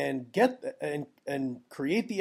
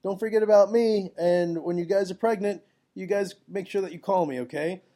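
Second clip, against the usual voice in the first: friendly reminder; 4 semitones higher, 5 dB louder than usual. Retold or imitated. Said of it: "you almost just wanna let them know, like".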